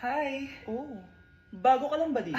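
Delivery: ordinary talk, nothing marked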